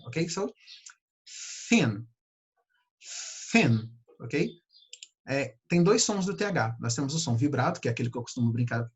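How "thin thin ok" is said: "thin" with air blown between the teeth; breathy th. Shown as a demonstration. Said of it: "but with air". ("thin" com o som assoprado)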